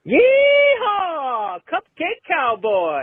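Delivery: high whooping yeehaw, then slow drawn-out cry; cowboy yell. Acted. Caller yelling his cowboy catchphrase, high and slow. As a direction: holler like a rodeo cowboy.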